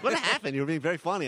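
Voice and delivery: baffled high exclaim; surprised. Retold or imitated. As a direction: ask in disbelief.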